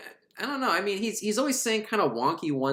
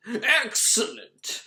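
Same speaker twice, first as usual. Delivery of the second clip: higher, drawn-out villain purr; mock villain; 6 semitones higher, much slower than usual. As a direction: savor it slowly like a scheming cartoon villain. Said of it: "the Horned King".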